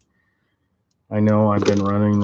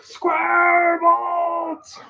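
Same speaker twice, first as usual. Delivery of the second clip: a mock rage scream, much higher, building to a cry; screaming on the job site; much slower than usual. Mechanic on the job site, shrieking over square bolts. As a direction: rant at the bolts like you've lost it. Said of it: "On the job site screaming".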